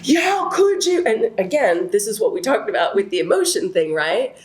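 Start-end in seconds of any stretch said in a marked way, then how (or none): 0.0-1.0 high-pitched mock yell at her fiance; retold yelling. Yelling at her fiance on the phone, high mock yell. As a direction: shoot the question up high, yelling. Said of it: "I'm just yelling about".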